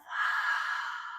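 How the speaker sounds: open-mouth breathy exhale, fogging a window; Ujjayi breath. Shown as a demonstration. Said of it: "Open the mouth".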